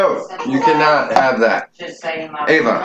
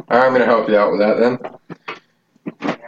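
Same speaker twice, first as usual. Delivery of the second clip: ordinary talk, nothing marked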